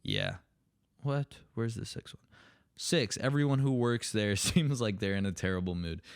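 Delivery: normal talking voice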